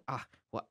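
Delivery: very low, very soft groan; imitating Frank's grunt. Describing Frank's voice actor, soft grunting imitation. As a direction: grunt it out under your breath. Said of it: "he'd go like".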